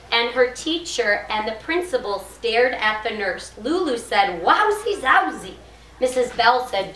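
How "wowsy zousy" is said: high, animated exclamation; excited. A narrator's reading. Lulu exclaims at the nurse, excited. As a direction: exclaim it like a storybook character. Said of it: "I'm making my voice sound excited".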